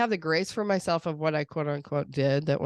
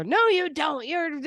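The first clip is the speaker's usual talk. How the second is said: higher, louder mock kid retort; kid pushing back; 10 semitones higher, 3 dB louder, slower than usual. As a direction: snap back like an unconvinced child. Kid rejecting parent's scripted empathy.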